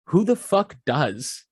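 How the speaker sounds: imitating an exasperated dad; exasperated demand. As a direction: draw it out as an indignant demand. Voices dad's exasperated retort on phone.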